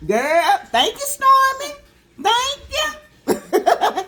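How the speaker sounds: squealing thank you with a laugh; gushing thanks. Real, not acted. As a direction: gush with delight to a friend. Thanking Stormy for hair product.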